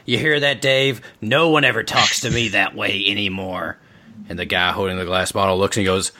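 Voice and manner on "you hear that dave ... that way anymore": higher, sharp scolding woman's voice; angry wife. Acted. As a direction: snap at Dave, put out. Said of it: "her face turns angry".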